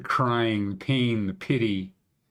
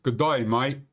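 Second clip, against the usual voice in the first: mock Aussie greeting, higher in pitch; Australian accent; 4 semitones higher than usual. Shown as a demonstration. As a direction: put on a jokey Australian twang. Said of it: "So that's my Banff accent".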